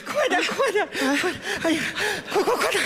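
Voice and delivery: high, urgent repeated hurry-up; panicked urging. Acted. Manager rushes young man to the phone, high-pitched and panicked. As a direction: hurry him along in comic alarm.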